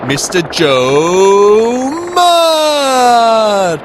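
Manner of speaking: drawn-out announcer intro. Acted. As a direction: stretch the name like a ring announcer. Grand guest introduction.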